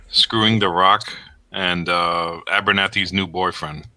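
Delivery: normal talking voice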